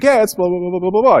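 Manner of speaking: high, babbled blah-blah imitation; gullible target. Target handing over his card number, mock eager babble.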